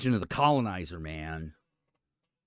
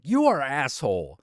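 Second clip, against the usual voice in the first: annoyed owner; 4 semitones higher, 7 dB louder than usual. Retold or imitated. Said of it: "The owner is like".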